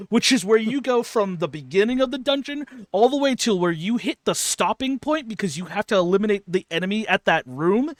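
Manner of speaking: ordinary delivery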